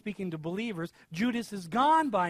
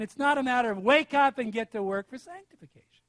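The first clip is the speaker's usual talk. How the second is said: ordinary talk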